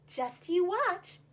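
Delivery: slow, knowing tease; hint at a surprise; slow teasing confidence; sly scallop. Acted.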